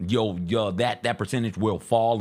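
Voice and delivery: ordinary talk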